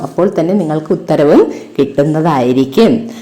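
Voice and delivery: ordinary talk, nothing marked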